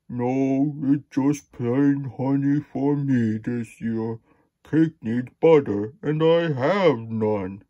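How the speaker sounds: slow, put-on bear voice; storybook bear. A narrator's reading. Bear telling Porcupine he has no butter, slow bear voice. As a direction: voice a big bear, drawing words out.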